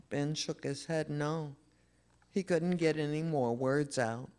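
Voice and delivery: ordinary delivery, nothing marked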